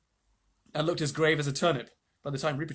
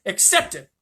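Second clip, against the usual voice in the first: angry king; 5 semitones higher, 9 dB louder than usual. Acted. Miraz voiced in read story.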